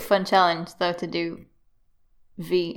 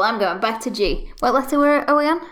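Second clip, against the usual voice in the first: normal talking voice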